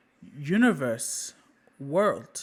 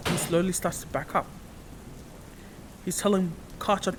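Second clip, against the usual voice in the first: usual speaking voice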